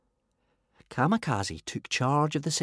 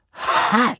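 puffed ha; 13 semitones higher, 4 dB louder than usual. A narrator's reading. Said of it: "puffed Toothless".

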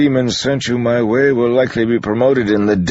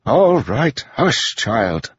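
exasperated hushing; 11 semitones higher, much slower than usual. A narrator's reading. Priest silencing a yelling girl.

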